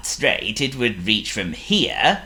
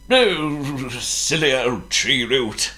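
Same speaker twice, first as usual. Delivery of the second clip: muttering wizard; 5 semitones higher, slower than usual. Acted. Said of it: "he muttered".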